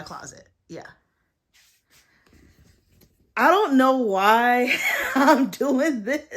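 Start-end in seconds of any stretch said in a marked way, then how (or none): 3.4-6.4 high, loud, drawn-out laughing complaint; slow, high, laughing disbelief; laughing in exasperation. Real, not acted. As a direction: break into a real laugh mid-sentence. Laughing at own messy project.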